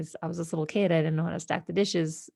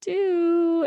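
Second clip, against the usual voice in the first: put-on lost child; 9 semitones higher, slower than usual. Kid lost, mom crying.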